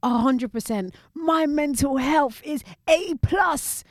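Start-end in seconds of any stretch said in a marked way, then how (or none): 1.1-3.8 higher, slower mock bragging; mock a perfect mental health claim; mock boast. Mocking people who claim perfect health, a high bragging tone. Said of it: "you can never be".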